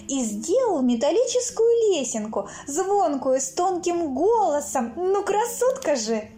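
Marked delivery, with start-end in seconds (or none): none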